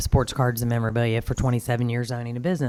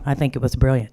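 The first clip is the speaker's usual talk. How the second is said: ordinary delivery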